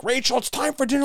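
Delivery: high call to dinner; calling upstairs. Acted. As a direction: call up the stairs to a kid. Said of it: "you go upstairs and you yell".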